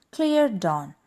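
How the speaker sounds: usual speaking voice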